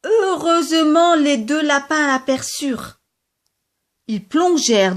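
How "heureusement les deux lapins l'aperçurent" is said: usual speaking voice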